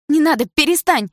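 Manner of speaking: high, loud pleading cry; scream. A narrator's reading. Dana begging her boyfriend to stop fighting, high scream. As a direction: cry out in alarm to stop him. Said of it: "закричала Дана".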